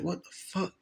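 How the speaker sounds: hushed, nervous what-the-fuck in the dark; scared hushed question. Retold or imitated. Boy alone in dark house, hushed and spooked. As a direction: breathe it out, spooked and unsure.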